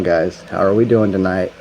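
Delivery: usual speaking voice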